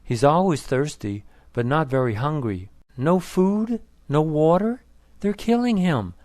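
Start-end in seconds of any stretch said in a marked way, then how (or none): 3.0-6.1 upset. Acted. Son upset about dying father.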